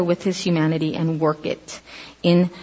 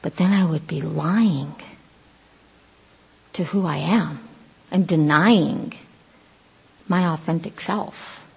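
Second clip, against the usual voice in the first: inner thought; 3 semitones lower, 6 dB quieter, slower than usual. Pretend. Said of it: "in Jesus' mind was like".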